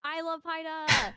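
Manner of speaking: loud, slow gushing fan shout; excited fan. Real, not acted. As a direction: gush over her like a fan.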